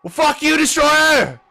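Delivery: high, loud yelled curse; shouted insult. Pretend. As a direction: scream it at the rival. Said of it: "He's like".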